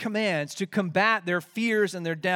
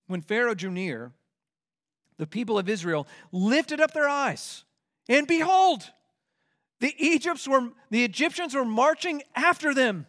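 rising, louder reading of the verse; dramatic scripture reading; 7 semitones higher, 4 dB louder than usual. Acted. Preacher reading the Exodus verse to the church with rising drama. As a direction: read it as a building climax.

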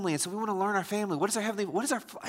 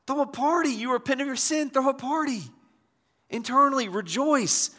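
high-pitched, rousing exhortation; celebrating; 7 semitones higher than usual. Acted. Preacher urging a repenter to celebrate, jubilant and high. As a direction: lift them out of shame.

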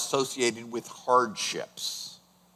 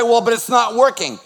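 higher, louder doubting protest; mock objection; 7 semitones higher, 6 dB louder than usual. Preacher voicing a doubting listener, loud protest. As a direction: push back like a frustrated believer.

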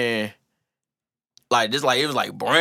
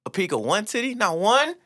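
mock indignation; 9 semitones higher than usual. Real, not acted. Mock outrage building.

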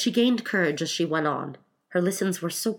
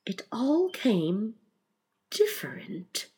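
thoughtful; 5 semitones higher, much slower than usual. A narrator's reading. Mock Turtle ponders Alice's verse, thoughtful and slow. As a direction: muse on each word, trailing into breath. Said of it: "the Mock Turtle repeated thoughtfully".

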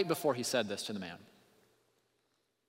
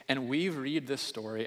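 ordinary talk, nothing marked

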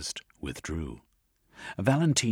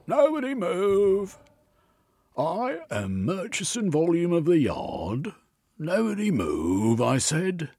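high, loud blustering copper's voice; pompous policeman storming in; bossy police inspector; 12 semitones higher, 5 dB louder than usual. Acted. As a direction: bark orders like a pompous inspector. Policeman bursts in, commanding.

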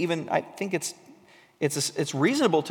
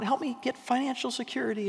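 pleading prayer; 7 semitones higher, 7 dB quieter than usual. Retold or imitated. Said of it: "and we go on and on".

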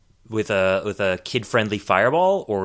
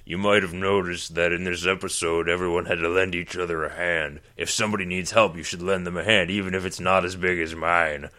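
low gravelly hero voice; lower, gravel-throated moral speech; 4 semitones lower than usual. Retold or imitated. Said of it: "this really gravelly voice".

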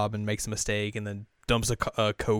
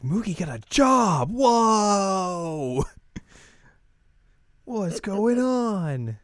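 high, loud, drawn-out astonishment; hammed-up wowed shout; mock amazement; 10 semitones higher, 5 dB louder, much slower than usual. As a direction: imitate friends gawping, vowels stretched. Friends amazed Mugi has job.

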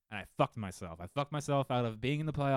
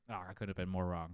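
ordinary talk, nothing marked

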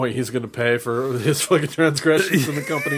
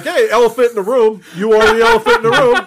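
loud, high-pitched imitation of a blunt stranger; hammed-up, tactless announcement; mock loud exclamation; 11 semitones higher, 7 dB louder than usual. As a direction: call it out, climbing much higher on the repeat. Mocking strangers' first conversation with him.

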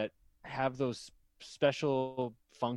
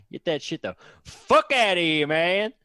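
louder, higher mock Southern outburst; dumb Southern voice; 4 semitones higher, 6 dB louder than usual. Scene: disbeliever throws out the science talker, dumb Southern voice. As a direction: shoo them off in a hammed-up Southern accent. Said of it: "use Southern accents as the dumb voice".

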